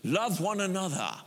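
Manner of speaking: mock British film-Jesus accent; movie Jesus voice. Mimicking Jesus in the movies, affected British accent. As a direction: put on a solemn, stagey British voice. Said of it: "blonde-haired guy who talks like this".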